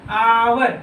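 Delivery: आवर loud, with a lengthened आ; teacher's emphatic, stretched आवर; sounded-out word. Shown as a demonstration. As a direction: punch out आवर, holding the first आ. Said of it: "देखो बोलने से ना आवर".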